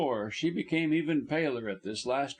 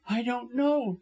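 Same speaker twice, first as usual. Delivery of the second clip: high, firm denial; haughty, deliberate answer; 9 semitones higher, 3 dB louder than usual. A narrator's reading. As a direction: state it pointedly, pitch raised. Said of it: "she repeated deliberately".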